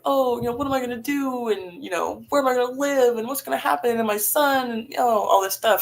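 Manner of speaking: mock her own spiralling worry; imitating panicked thoughts.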